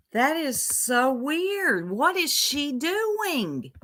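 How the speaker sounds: slow, high, drawn-out dismay; baffled disbelief. Real, not acted. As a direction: stretch it out, appalled at the weirdness. Baffled at the clip's odd laugh.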